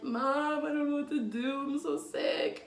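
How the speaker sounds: lightly tearful call to mom for help; whiny sick plea. Retold or imitated. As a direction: sound miserable and small. Tearfully calling her mom.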